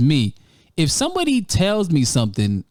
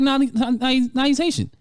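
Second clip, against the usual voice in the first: higher mock insistent voice; higher mock-stubborn ex; mocking insistence; 8 semitones higher than usual. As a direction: pitch up to mimic her stubborn insisting. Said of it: "He's like".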